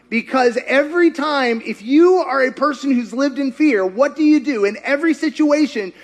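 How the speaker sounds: usual speaking voice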